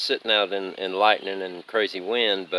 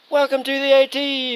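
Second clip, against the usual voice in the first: usual speaking voice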